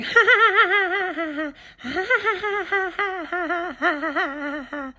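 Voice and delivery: high mocking cackle, fading out; witch cackle. Imitating a woman on Fifteenth laughing, high witch cackle. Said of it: "like a witch flying on a broom".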